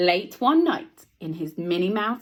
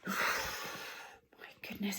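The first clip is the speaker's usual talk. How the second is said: awed whisper; 14 dB quieter, much slower than usual. Real, not acted. Awed at big lion.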